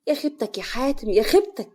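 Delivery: high, loud lament of a scandalized mother; horrified wailing. A narrator's reading. Mother discovering her son's wife betrays him, wailing in horror. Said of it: "بتمسك صدرها وتقول".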